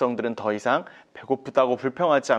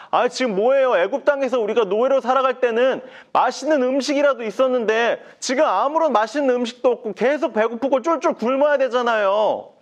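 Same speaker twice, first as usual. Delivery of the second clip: higher, whiny complaining voice; grumbling Israelites; 8 semitones higher, 3 dB louder than usual. Acted. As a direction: whine about the hunger. People complain to Moses. (높은 목소리로 투덜대는 백성)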